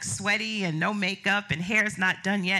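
usual speaking voice

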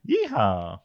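playful cowboy holler; lightly voiced yeehaw. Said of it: "why did he say "Yeehaw".

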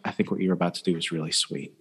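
soft, low, quick murmur; imitated soft sweetness. Woman stroking his arm to praise him, imitated softly. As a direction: drop the volume and pitch, imitating an admirer.

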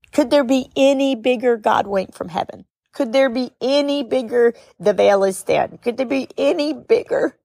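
tearful, pitch pushed high; choked up, voice breaking upward; real tears as she speaks; crying. Real, not acted. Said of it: "I start to cry like this".

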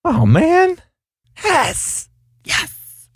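thrilled, high, fading to whisper; excited. Real, not acted. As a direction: squeal with delight. Overjoyed at wedding invite.

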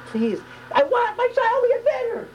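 very high, drawn-out desperate plea; passionate scream. Shown as a demonstration. Parent begging for a sick child, passionate scream. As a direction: cry it out slowly from the depths. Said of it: "It's a scream, it's a passion".

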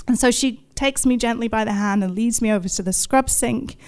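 ordinary talk, nothing marked

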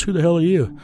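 sharp who-the-hell, half acted; a touch of an angry stranger; mild mock challenge. Suit guy confronts intruder, retold.

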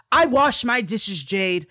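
high, loud and slow, mock defensive; imitating him snapping back defensively; defensive retort. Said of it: "starts getting defensive".

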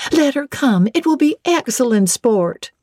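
high-pitched youthful eagerness; excited youngsters wanting fun, unshouted; eager cry. A narrator's reading. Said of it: "cried all the juveniles".

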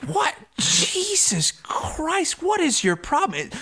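high, startled imitation of himself; shocked protest. Reporter shoved aside, high incredulous protest. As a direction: react stunned and indignant. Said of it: "I was like".

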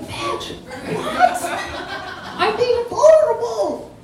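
very high, incredulous protest; mock outrage. Storyteller protesting chiropractor's silence, high mock outrage. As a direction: squeal it in comic indignation. Acted outrage at unanswered text.

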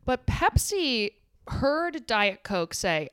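usual speaking voice

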